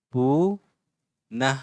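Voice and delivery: "पुनः" very slowly, first vowel stretched, then a pause; drawn-out syllables. Shown as a demonstration. Teacher slowing "पुनः" for pupils, syllable by syllable.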